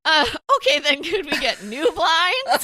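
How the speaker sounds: meek husband trying again; husband's voice. Retold or imitated.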